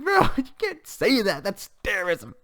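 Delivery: high, slow amused protest through a laugh; laughing disbelief. Real, not acted. Reviewer laughing at Megumin's church threat, amused. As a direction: laugh out that it's terrorism.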